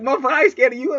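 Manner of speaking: high, loud cocky dog; hammed-up tough little dog talk; defiant little dog voice. Little dog defying the man, high cocky voice. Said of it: "that one little dog was like".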